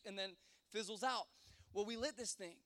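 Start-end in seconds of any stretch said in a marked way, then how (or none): none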